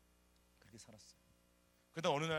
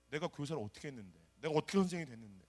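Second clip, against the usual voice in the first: ordinary delivery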